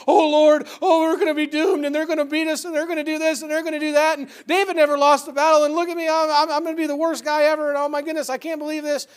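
loud, high, desperate wail to the Lord; pleading Joshua. Acted. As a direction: cry out as a doomed man begging. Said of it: "and he's pleading".

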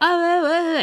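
high-pitched nonsense babble, mimicking what was heard; imitated garbled speech. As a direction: mimic words blurring into meaningless noise. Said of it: "tapi gua dengernya kayak".

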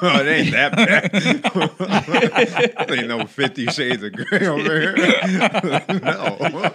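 loud, high, cracking up while protesting; laughing denial. Real, not acted. Guest denying host's racy misreading of a massage story, laughing loudly. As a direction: laugh hard between the words.

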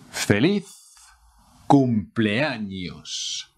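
"cumpleaños" drawn out slowly; slow model. Shown as a demonstration. Teacher modelling the greeting slowly. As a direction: stretch "cumpleaños" for learners. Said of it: "How to say happy birthday".

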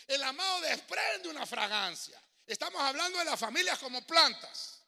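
loud, high, slow preacher's cry; full-force sermon climax; fiery preaching. Real, not acted. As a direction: preach it out to the hall.

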